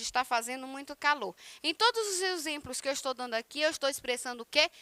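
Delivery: ordinary delivery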